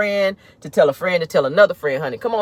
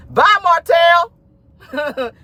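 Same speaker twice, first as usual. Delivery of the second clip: laughing goodbye; 10 semitones higher, 4 dB louder, much slower than usual. Real, not acted.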